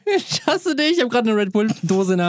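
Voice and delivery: high laughing mock outrage. Real, not acted. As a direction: squeal it while laughing. Caught holding a Red Bull.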